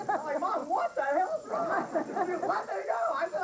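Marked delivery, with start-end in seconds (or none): 0.4-1.9 much higher, slow, pleading alarm; alarmed retold question. Son pins his mother's wrists and asks what's wrong, high and alarmed. 2.4-3.1 higher, lightly voiced angry mother; a hint of a furious mother struggling free; mother's mock demand. Mother demands her son release her wrists, mock angry.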